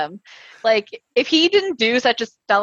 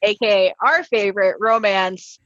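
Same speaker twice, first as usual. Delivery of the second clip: normal talking voice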